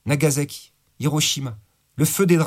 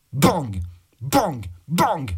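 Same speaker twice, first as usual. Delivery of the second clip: gunshot bangs; much slower than usual. A narrator's reading. Tino fires his gun.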